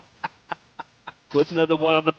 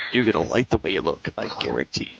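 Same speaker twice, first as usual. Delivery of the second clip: much lower, growling slogan read; deep ad pitchman voice; 10 semitones lower than usual. Pretend. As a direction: drop low like an old TV ad. Repeating the outro catchphrase, deep.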